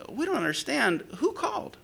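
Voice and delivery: high, puzzled voice rising at the end; imitated baffled couple. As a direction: mimic bewildered complainers with a high questioning lift. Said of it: "they're both saying the same thing".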